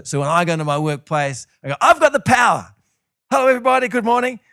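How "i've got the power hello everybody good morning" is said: loud, high, hammed-up triumph; big showy mock-triumphant call; cocky announcement. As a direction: burst in hailing the whole office. Acts swaggering into his workplace.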